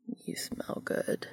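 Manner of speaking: slow, raspy whisper; sandpaper voice. A narrator's reading. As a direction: rasp it out low and close. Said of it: "voice like sandpaper".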